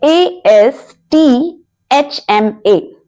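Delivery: letter by letter, spaced and slow; slowly spelt letters; slow spelling. Shown as a demonstration. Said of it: "spelling the word".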